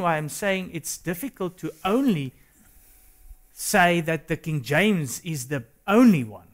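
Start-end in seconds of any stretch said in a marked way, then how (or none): none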